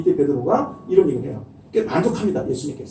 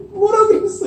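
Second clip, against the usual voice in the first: high, louder laughing mock snap; snapping back; 5 dB louder than usual. Husband or wife retorting when hurt, mock snapping and laughing. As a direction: fire back with a laugh, offended. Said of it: "이렇게는 쉽게 잘 안 되지만".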